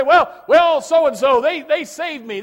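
high, loud mimicry of a grateful convert; mock shouted praise. Preacher mimicking a convert who credits the soul winner, loud. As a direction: shout it like someone thanking a person instead of God.

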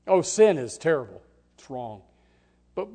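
ordinary delivery

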